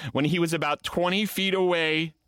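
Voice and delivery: usual speaking voice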